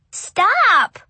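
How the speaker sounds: put-on high, loud child's cry; shout. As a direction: yell it like a disgusted kid. Child cries out in disgust.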